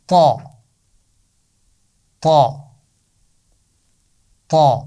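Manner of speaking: طا with a thick, heavy t; heavy ta sound. Shown as a demonstration. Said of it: "اس کی آواز بنے گی". (طا کی موٹی آواز)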